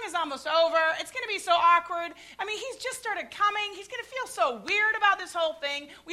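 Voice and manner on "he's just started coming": ordinary delivery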